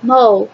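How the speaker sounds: "mo" said as one separate syllable; pronunciation demo. Shown as a demonstration. Teacher showing the class how the first syllable sounds, demonstrating "mo". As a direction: model "mo" on its own for learners. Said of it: "pronunciación de esto sería".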